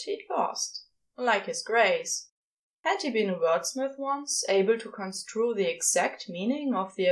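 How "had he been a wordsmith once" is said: ordinary delivery, nothing marked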